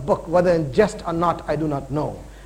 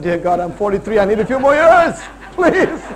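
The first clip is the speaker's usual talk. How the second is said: loud, half-laughing plea for more years; mock pleading to God; 7 dB louder than usual. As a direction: beg God jokingly, breaking into a laugh. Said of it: "I said".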